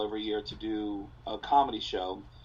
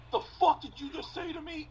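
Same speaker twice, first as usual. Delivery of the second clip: much higher mock tough-guy voice; angry heckler. Heckler confronting the comic, in a much higher mock-angry voice. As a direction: play the offended drunk. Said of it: "And the guy goes".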